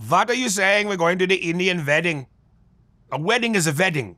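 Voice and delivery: light Indian accent with v for w. Shown as a demonstration. Asked to do Indian, he puts on an Indian accent. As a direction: swaps every w for a v, Indian style. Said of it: "Can you do Indian?".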